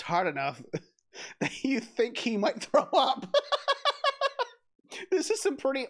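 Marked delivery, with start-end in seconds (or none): 1.4-3.3 talking through laughter; laughing. Real, not acted. Laughing while narrating. 3.4-4.5 high burst of laughter. Real, not acted.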